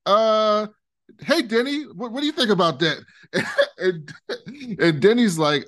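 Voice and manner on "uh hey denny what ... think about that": mock nervous deflection. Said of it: "So she goes".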